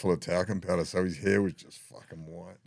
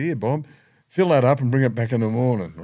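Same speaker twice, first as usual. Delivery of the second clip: louder, higher nurse voice; nurse handing jar; 6 semitones higher, 12 dB louder than usual. Retold or imitated. Old nurse telling Bob to fill the jar, louder. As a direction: play the nurse brightly handing it over.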